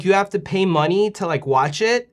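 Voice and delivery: ordinary talk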